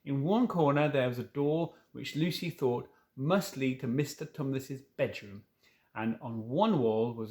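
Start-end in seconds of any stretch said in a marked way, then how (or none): none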